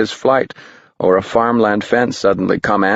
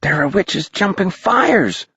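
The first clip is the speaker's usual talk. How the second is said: put-on high, wondering kid; a boy pointing below; higher young voice; excited boy; 10 semitones higher than usual. A narrator's reading.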